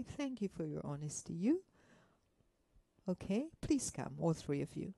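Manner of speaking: normal talking voice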